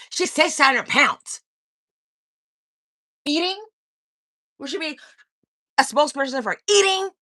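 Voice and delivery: mock her, pitch climbing; mocking jab. Real, not acted. Mocking insult, rising pitch.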